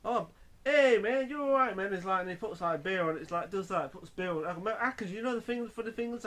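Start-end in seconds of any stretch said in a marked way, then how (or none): 0.0-1.9 high, loud and slow, mockingly friendly; hammed-up barroom taunt; mock-friendly taunt. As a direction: drawl it out like a cocky cowboy taunting a stranger. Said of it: "he says to him, like".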